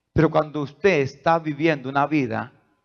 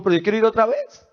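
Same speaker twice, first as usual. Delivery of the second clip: imitating a child; 7 semitones higher, faster than usual.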